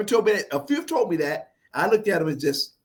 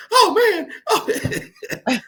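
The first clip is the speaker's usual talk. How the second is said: very high, loud mock groan with laughter; imitated exasperated dudes; 4 dB louder, much slower than usual. Guys reacting to his funny comeback, imitated high exclamation. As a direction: squeal it as they would, half laughing. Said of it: "They be like".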